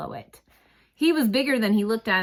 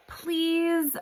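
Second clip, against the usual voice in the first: pleading; 9 semitones higher than usual. A narrator's reading. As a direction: beg him gently, voice lifted much higher. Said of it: "I said".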